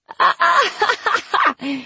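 very high shrieking laughter; piercingly high cackling laugh; screaming laugh. A narrator's reading. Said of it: "pretty much started screaming her laugh".

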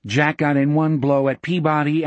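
usual speaking voice

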